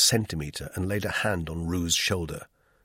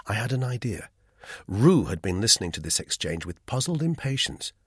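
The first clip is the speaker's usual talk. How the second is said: ordinary delivery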